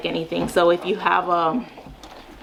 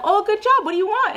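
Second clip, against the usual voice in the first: much higher, bright cheering; much higher praise, then a bright question; excited praise; 12 semitones higher than usual. Shown as a demonstration. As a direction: cheer the child on, then ask. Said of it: "they'll be like".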